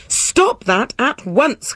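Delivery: slow, high-pitched royal command; imperious king's order. A narrator's reading. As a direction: order it sternly, drawing out the words. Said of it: "commanded the king".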